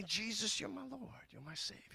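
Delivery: very soft, intimate confession; hushed prayer. Acted. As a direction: drop right down as if praying privately.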